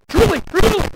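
urgent high double cry; emphatic high exclaim. Acted. Preacher voicing Jesus to crowd, high emphatic cry. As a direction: pitch it up high and ring it out.

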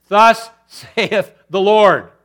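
loud, slow, drawn-out proclamation; emphatic proclamation. Acted. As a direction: stretch it out with a laugh.

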